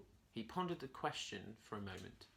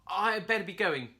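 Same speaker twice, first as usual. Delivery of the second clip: higher, louder nervous monkey blurting; nervous quick exit; 8 semitones higher, 9 dB louder than usual. A narrator's reading. Curly hurries away from the hungry jaguar, nervous and loud. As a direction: back away fast from the glaring jaguar. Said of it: "Curly said quickly".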